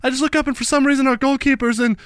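lightly hammed-up footballer answer; imitating Rooney. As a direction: play the baffled captain. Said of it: "He goes".

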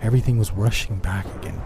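plain reading voice

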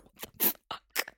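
slow, voiceless whisper of disbelief; stunned whisper. Retold or imitated. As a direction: mouth it slowly under your breath. Stunned reaction to the huge fee.

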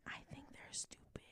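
unvoiced whisper under her breath; whispered aside. Real, not acted.

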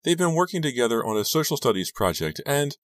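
put-on high, plaintive girl's voice; upset teenage Rachel. A narrator's reading. Rachel explaining the breakup to her friend, upset.

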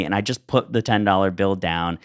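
ordinary delivery

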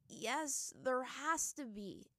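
sincere. A narrator's reading. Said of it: "Aang said sincerely".

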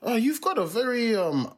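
imitating a tentative, curious stranger; lightly voiced customer. Retold nosy customer probing his accent.

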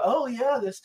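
high, mocking sing-song; mocking liberals. Mocking smug liberals to his friend. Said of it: "and they go".

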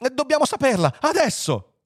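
high, urgent demand; impatient exclamation. A narrator's reading. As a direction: demand it right away, excitedly. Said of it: "esclamò Fuchs". (richiesta acuta e impaziente)

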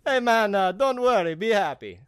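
high, loud hammed-up Jamaican accent; playful Jamaican accent drifting toward Dracula; mock Jamaican accent. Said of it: "I went from Jamaican".

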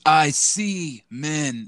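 drawn out very slowly; Roman Reigns. Retold or imitated. Wrestler delivering a bad pun to camera, very slow and deliberate. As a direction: stretch each word out for the joke. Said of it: "Roman Reigns came out and says".

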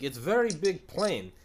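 high, drawn-out mock Russian accent; loud, slow Russian-accented impersonation. Soviet guy explaining the plane in a documentary, mock Russian accent. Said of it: "Soviet guy, Russian guy talking".

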